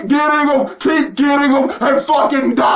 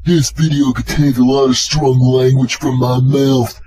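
much lower, mock-ominous warning voice; deep announcer voice; 12 semitones lower than usual. Vlogger warns viewers, in a deep mock announcer voice. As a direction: boom out the warning like a trailer narrator.

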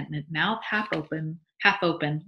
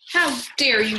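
high, loud indignant outburst; furious wife, voice raised; outraged cry; 6 semitones higher, 6 dB louder than usual. A narrator's reading. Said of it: "she cried".

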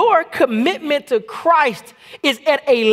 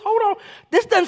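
"Hold on" jumped way up high in shock; surprised; 10 semitones higher than usual. Shown as a demonstration. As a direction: stop short with a high startled "Hold on".

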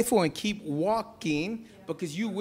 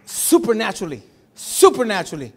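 loud, ringing repeat of Supernaturally; proclaimed; 3 semitones higher, 7 dB louder than usual. Real, not acted. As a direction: declare Supernaturally to the room, louder. Repeated word proclaimed loudly.

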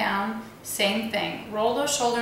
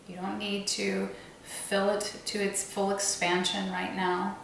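calm, unhurried meditation voice with long pauses; guided meditation; 5 dB quieter than usual. Real, not acted. Meditation teacher guiding listeners to visualize their body outline, calm guided meditation. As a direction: guide them gently, leaving space between phrases.

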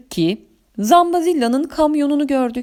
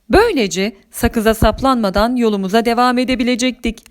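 usual speaking voice

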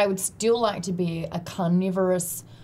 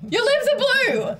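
alarmed; 4 dB louder than usual. Real, not acted. Repeats alarm at blue lips.

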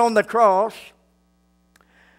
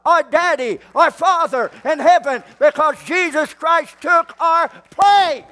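rising preaching fervor; 5 semitones higher than usual. Real, not acted. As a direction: lift the church with each one day. Building to full-force preaching.

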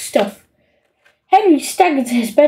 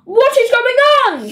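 shrieked; 11 semitones higher than usual. A narrator's reading. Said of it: "shrieked Mum and Dad".

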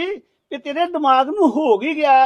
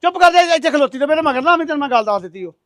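loud, higher furious command; angry shouting; 3 semitones higher, 5 dB louder than usual. Acted. Husband ordering his wife to stay behind, shouting angrily. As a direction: bark her into silence. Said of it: "ਇੰਨਾ ਗੁੱਸੇ 'ਚ ਨਾ ਆਇਆ ਕਰੋ".

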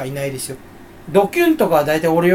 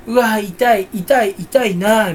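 mock ouch gag; 3 semitones higher, 3 dB louder, much slower than usual. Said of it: "っていうギャグあるじゃん".

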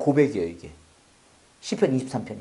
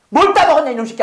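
higher, louder mock scolding; hammed-up angry accusation; mock angry God; 9 semitones higher, 8 dB louder than usual. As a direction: bark it like a furious father catching a thief. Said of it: "안 했어요".

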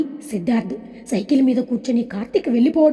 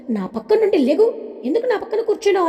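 angry shout; 8 semitones higher than usual. A narrator's reading. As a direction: snap at him to get away, fast and high. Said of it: "కోపంగా అరుస్తూ ఉంటుంది".

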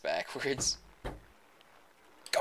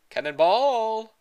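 drawn-out high shout; cannonball yell; 10 semitones higher, 10 dB louder, slower than usual. Pretend. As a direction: shout it as you jump in. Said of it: "little boy first".

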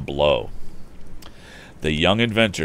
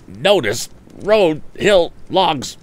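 higher, louder flustered stammer; stammering bully; 12 semitones higher, 6 dB louder, slower than usual. Acted. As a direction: sputter it out caught off guard. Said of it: "stammered Andy".

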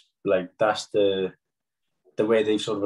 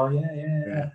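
imitating polite, higher-pitched agreement; polite pretence; 7 semitones higher than usual. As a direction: nod along as if you understood. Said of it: "They were trying to be polite".

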